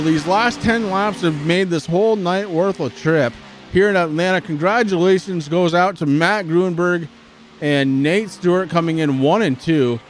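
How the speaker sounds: excited race call, high and loud; live play-by-play. Real, not acted. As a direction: call the finish like a race announcer.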